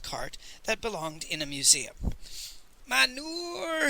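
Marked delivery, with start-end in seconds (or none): 2.9-3.9 high, long-drawn mournful street cry; quavering melancholy shout. A narrator's reading. Old peasant hawking from his cart, quavering and melancholy. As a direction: call it out high and sorrowfully, stretching the vowel. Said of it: "in a quavering, melancholy voice".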